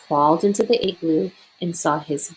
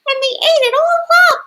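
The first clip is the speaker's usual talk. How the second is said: very high, louder squeaky cub voice; high squeaky baby bear; 6 dB louder than usual. A narrator's reading. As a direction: squeak it like an indignant little cub. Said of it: "in her high, squeaky voice".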